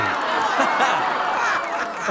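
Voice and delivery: high, gleeful cackle; gloating laugh. Acted. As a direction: cackle in triumph. Gloating after the reveal.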